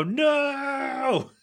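high, long drawn-out mock wail of refusal; annoyed refusal. Annoyed cook refusing a texted order, drawn-out high mock protest. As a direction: stretch it out high, imitating exasperation. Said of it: "he's really annoyed at that".

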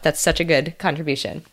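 ordinary delivery

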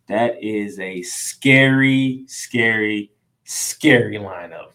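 drawing out scary, scary, scary for effect; stress on scary. Real, not acted. Talking up a stacked lineup, drawn-out emphatic scary.

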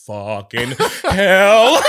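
loud, drawn-out cursing in mock horror; retold shock. Swimmer spotting a huge ray below him, drawn-out shock. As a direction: stretch it out loud and slow, aghast.

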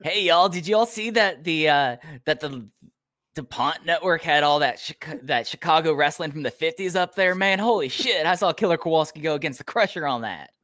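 put-on excited Southern good old boy; put-on Southern accent. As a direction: gush like a Southern wrestling fan. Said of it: "I'm allowed to do the accent".

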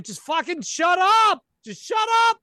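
furious high shout; screaming. Acted. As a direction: scream it to make the noise stop. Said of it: "you start screaming".

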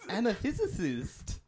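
high, slow hissing snake. Pretend. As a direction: play a snake hissing the s's. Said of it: "a snake's words".

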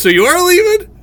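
sharp high alarmed question; surprised. Real, not acted. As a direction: jump up in pitch, shocked. Host startled by goodbye remark.

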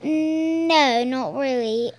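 ordinary talk, nothing marked

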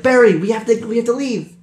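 high-pitched character voice. Acted. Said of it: "He's like".

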